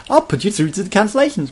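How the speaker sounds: squeaky high, loud sales pitch; cheery pushy salesperson. Retold or imitated. Sky agent pushing cancellation, high cheery salesperson voice. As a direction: ham up an eager agent rushing him through.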